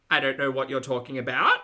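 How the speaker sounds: loud demo of upspeak, "about" swept up like a question; Australian question intonation. Shown as a demonstration. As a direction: lift "about" sharply into a question. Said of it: "Australian question intonation".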